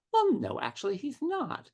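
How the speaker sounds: high-pitched, hesitant retold confession; reluctant admission. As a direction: break it gently, voice climbing high. Reluctant admission to her mother, retold.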